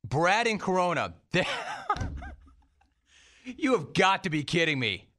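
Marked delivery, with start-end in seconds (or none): 1.3-2.3 high burst of stunned laughter; a sudden high laugh of disbelief; shocked laugh. Real, not acted. Host reacts to shocking call.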